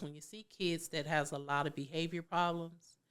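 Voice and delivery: ordinary talk, nothing marked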